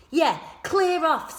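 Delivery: high, loud, slow drawn-out echo; echoing taunt. A narrator's reading. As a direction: back up your friend. Friend echoing the order.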